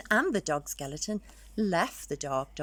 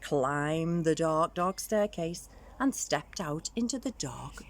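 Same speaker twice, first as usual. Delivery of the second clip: plain reading voice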